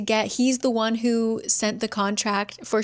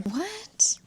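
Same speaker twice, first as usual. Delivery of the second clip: much higher startled question; baffled what; 12 semitones higher, 4 dB quieter than usual. Real, not acted. Reacting to a senseless plot twist, high disbelief. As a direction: yelp it in disbelief.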